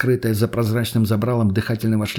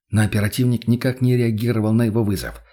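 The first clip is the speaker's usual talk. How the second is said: ordinary delivery, nothing marked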